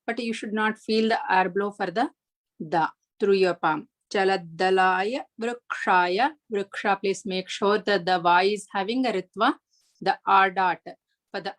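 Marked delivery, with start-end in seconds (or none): 4.1-6.3 Chala dalaya vrukshaya slowly, with a firm unaspirated da; careful pronunciation. Shown as a demonstration. Teacher shows the stressed da to students, slow and careful. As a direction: press the da in Chala dalaya without a puff of air. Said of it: "you have to stress on the da".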